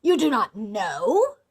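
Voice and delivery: loud, drawn-out incredulous question; menacing mock disbelief; exclaiming in disbelief. A narrator's reading. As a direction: throw it back at her slowly, loudly. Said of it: "exclaimed Bluebeard".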